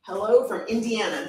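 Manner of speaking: usual speaking voice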